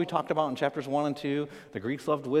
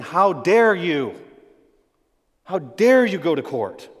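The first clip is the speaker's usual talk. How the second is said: slow, drawn-out, louder scolding; outraged apostle scolding; stern rebuke; 7 dB louder, slower than usual. Acted. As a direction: lean on every word like a rebuke. Paul rebuking the church.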